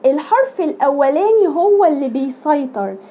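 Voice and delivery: usual speaking voice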